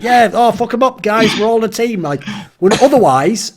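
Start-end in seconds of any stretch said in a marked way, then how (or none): 0.0-2.0 high-pitched mock rallying cry; rally the lads as one team, hammed up. Brits uniting against other people, mock team rallying.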